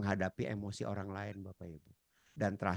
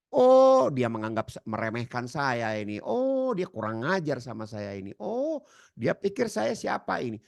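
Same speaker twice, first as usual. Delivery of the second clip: offended outrage; 3 semitones higher, 7 dB louder than usual. Acted. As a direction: work yourself up into offended anger. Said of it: "lalu si baju kuning ini berpikir". (marah tersinggung, nada tinggi dan keras)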